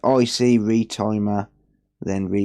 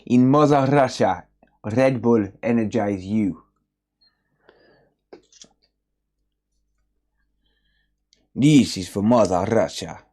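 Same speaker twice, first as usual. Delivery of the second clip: a light mock Russian accent, "Mother Russia" said as "Mozza Rasha"; lightly voiced Russian, with heavy rolled vowels in "Russia". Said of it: "Which is why the Russian accent".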